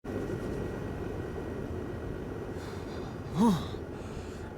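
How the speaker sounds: shivering sigh. Real, not acted. Cold wrestler waiting backstage.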